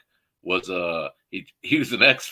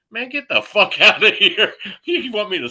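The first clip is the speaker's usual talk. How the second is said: higher and louder, laughing through it; incredulous outburst; 10 semitones higher, 8 dB louder than usual. Retold or imitated. Teenage dishwasher to the cook, loud laughing disbelief. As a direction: blurt it out in disbelief, cracking up. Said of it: "I'm like".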